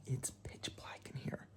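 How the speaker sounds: hushed whisper in the dark. Real, not acted. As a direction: whisper it softly. Dark hotel room at night.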